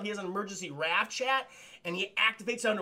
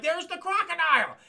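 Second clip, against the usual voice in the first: excited call; 10 semitones higher, 5 dB louder than usual. Retold or imitated. Excited spotting.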